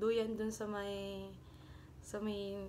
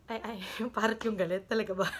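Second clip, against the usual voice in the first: real laughter, louder; laughing at herself; 5 dB louder, faster than usual. Real, not acted. Speaker catching her own story slip, laughing. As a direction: crack up mid-sentence.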